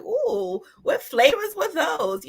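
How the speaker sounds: high-pitched, intrigued "ooh" into a question; curious. Retold or imitated. Panelist recalling her reaction to the cupcakes, curious. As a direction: perk up with nosy interest. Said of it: "I was a little curious".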